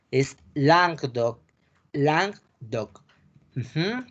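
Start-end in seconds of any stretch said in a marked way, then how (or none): 0.1-3.0 Languedoc said slowly as lahng-DOK; French pronunciation. Shown as a demonstration. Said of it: "Languedoc, le dicen por acá".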